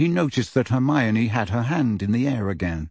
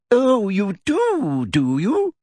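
mocking sweet voice; 9 semitones higher, much slower than usual. Umbridge's mocking retort.

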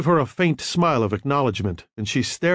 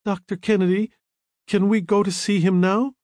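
timid; 7 semitones higher than usual. A narrator's reading. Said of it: "asked timidly".

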